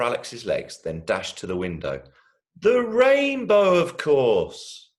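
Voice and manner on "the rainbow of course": put-on high, loud, slow wonder-struck kid; excited child. Little brother pointing out the rainbow, high excited child voice. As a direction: announce it like a delighted little boy.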